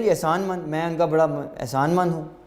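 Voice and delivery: ordinary talk, nothing marked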